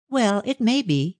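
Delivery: a daughter hedging before her mother; hesitating. A narrator's reading. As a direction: hold back, unsure what to admit. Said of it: "she said hesitatingly".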